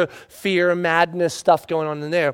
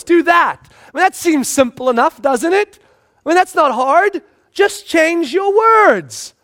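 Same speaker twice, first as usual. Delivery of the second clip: much higher, louder emphatic preaching; preaching at full force; 9 semitones higher, 5 dB louder than usual. Acted. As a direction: drive the point home. Preaching climax.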